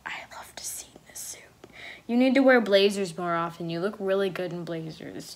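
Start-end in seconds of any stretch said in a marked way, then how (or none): 0.1-1.4 very soft, near-whispered aside; hushed repeat. Real, not acted. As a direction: repeat it fondly under your breath. Repeats fondly under breath.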